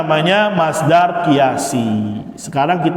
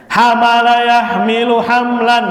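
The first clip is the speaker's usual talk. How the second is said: recitation drill; 7 semitones higher than usual. Shown as a demonstration. Teacher reciting the masdar gallery aloud, chanting. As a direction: recite each pattern clearly for the class.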